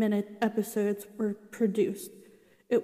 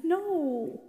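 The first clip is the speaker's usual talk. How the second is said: upset no; 7 semitones higher than usual. Retold or imitated. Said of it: "I was just so mad".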